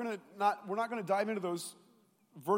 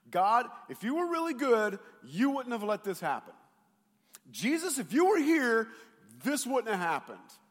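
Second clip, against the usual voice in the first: accusing complaint; 5 semitones higher, 5 dB louder than usual. Acted. Doubting people voiced accusing God.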